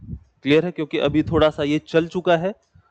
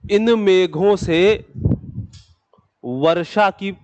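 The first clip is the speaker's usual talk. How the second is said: measured dictation, raised pitch on the opening phrase; slow dictation; 3 semitones higher, much slower than usual. Real, not acted. Teacher dictating a line of notes to students, slow dictation.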